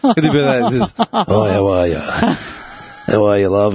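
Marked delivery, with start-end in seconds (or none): 1.1-3.7 low, drawn-out drag queen greeting. Acted. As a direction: put on a drag queen persona, slow and low. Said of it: "drag queen voice on".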